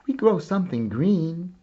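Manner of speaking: ordinary delivery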